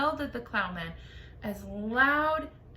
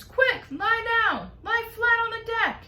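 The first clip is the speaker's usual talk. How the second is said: urgent shouted orders; 12 semitones higher, 7 dB louder than usual. A narrator's reading. James warning the others.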